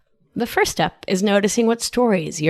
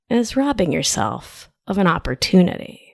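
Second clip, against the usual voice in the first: ordinary delivery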